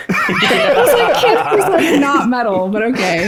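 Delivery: incredulous, laughing dismissal; mock disbelief. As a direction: scoff at it, laughing. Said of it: "I was like".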